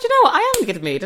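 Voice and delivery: high-pitched opening, upbeat retelling; lightly excited quoted reply; upbeat retold reply. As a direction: act out a cheerful realisation. Acts out own happy reply.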